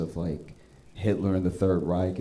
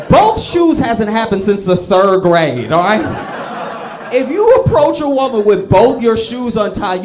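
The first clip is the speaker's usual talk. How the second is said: much higher and louder, cracking up; loud laughing rant; 10 semitones higher, 12 dB louder than usual. Real, not acted. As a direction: shout it through your own laughter. Ranting about untied shoes, laughing.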